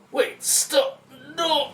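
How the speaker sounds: high, loud terrified cries; yelling in terror before the crash; panicked scream. Acted. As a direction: scream as the bike loses control. Motorbike crash about to happen.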